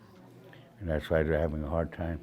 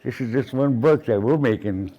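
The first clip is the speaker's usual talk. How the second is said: louder, higher self-defence; emphatic retold retort; 5 semitones higher, 5 dB louder than usual. Author retelling his defence of one book, emphatic and raised. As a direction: raise your voice to argue the point.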